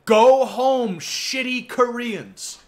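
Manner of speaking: high, loud, drawn-out jeering yell; mock yell, high and slow on every word; imitated angry shout. Streamer quoting a woman yelling at Koreans, loud imitated shout. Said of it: "Japanese woman shouting at the Korean district".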